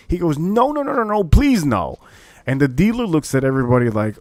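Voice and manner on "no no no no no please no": high-pitched wailing plea, dropping at the end; desperate pleading. Retold or imitated. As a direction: act out a loser begging frantically. Retold loser begs, acted loudly.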